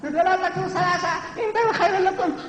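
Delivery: very high, squeaky chicken voice; mocking meek recitation. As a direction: parody a timid reciter in falsetto. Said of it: "That's not my Allah, that's not my Rasul".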